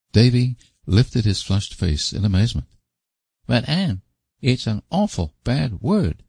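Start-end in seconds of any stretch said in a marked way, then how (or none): 3.5-6.2 put-on high doubtful boyish protest; hesitant little boy. A narrator's reading. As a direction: hesitate like a shocked child. Davy hesitant.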